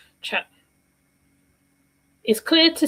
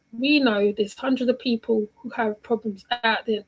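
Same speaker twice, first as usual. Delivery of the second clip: usual speaking voice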